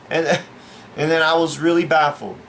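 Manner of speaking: ordinary talk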